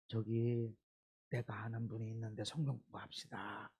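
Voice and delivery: very soft, low coaxing offer; hushed sly invitation. Retold or imitated. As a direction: lure them in quietly. Recruiter invites to Bible study.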